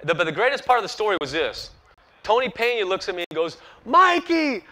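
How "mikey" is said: put-on high, excited call with a Dominican accent; Dominican manager's accent. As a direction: shout his name like the thrilled Dominican skipper. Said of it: "He's from Dominican Republic".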